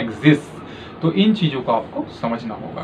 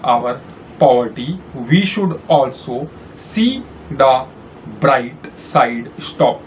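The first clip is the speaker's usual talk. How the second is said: slow, clearly spaced dictation voice; dictating a passage for a shorthand test; slow dictation read; much slower than usual. Shown as a demonstration. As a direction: read each phrase out slowly for typing practice. Dictation reading to typists.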